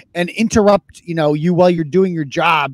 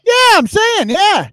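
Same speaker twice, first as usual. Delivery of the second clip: excited; 13 semitones higher, 4 dB louder, slower than usual. Real, not acted. Emphatic agreement.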